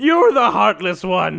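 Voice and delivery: loud, high, stretched-out retort; indignant, loud comeback; offended. Real, not acted. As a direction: throw the accusation back at full volume. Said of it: "I got offended".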